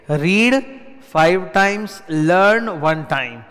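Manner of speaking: stamp the rule in word by word; punchy slogan. Shown as a demonstration. Teacher drilling the study rule into students, slow and emphatic. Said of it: "मतलब तुम इस chapter को उठाओ".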